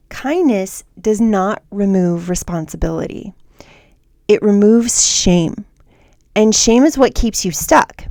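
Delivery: ordinary delivery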